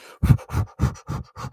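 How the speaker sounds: string of quick forceful puffs; rapid huffed exhales; calming breaths. Real, not acted. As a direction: blow out short breaths to steady yourself. Calming himself down mid-game.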